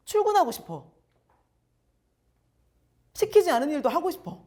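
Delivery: keen worker voiced with a lift in pitch; eager employee. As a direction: sound like you can't wait to get to work. Voicing eager motivated employee.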